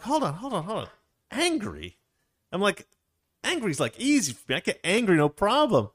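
high-pitched protest to the coach; retelling own protest. Retold or imitated.

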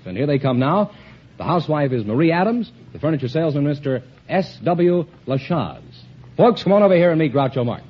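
ordinary talk

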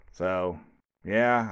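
usual speaking voice

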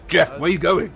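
stern encouragement. Acted. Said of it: "give stern words of encouragement".

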